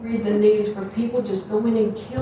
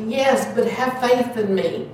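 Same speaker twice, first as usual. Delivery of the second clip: ordinary talk, nothing marked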